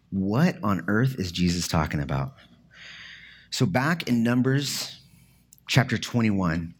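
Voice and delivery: ordinary talk, nothing marked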